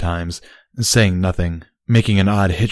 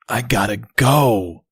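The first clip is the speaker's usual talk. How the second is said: urgent; 3 semitones higher, slower than usual. Acted. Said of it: "his words full of urgency".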